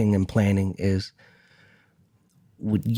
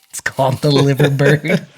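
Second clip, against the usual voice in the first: higher, amused, laugh breaking in; 4 semitones higher, 4 dB louder than usual. Real, not acted. Fan explains the bird on his hat, laughing. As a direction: say it through a laugh.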